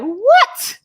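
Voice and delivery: high, loud disbelieving what; a loud incredulous squeak; stunned what. Retold or imitated. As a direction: shoot it up high in shock. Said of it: "And I just be like".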